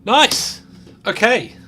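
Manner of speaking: triumphant rush to the finish line; excited. Real, not acted. Finishing the track, thrilled.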